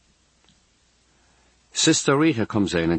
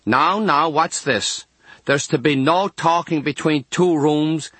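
lightly voiced scolding nun, raised pitch; Sister Rita; 6 semitones higher than usual. A narrator's reading. Nun catching two patients talking, scolding. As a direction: scold with measured firmness. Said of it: "then Sister Rita's voice".

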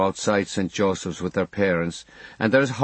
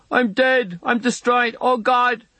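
put-on much higher, yelping Limerick boy in pain; 13 semitones higher, slower than usual. A narrator's reading. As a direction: yelp it out like a hurt, terrified boy.

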